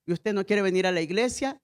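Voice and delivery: ordinary talk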